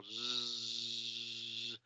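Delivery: zzz muy largo y suave, zumbando; sostén zzz como algo que está vibrando; Z vibrante. Shown as a demonstration. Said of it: "como que algo está vibrando". (zumbido sostenido de zeta)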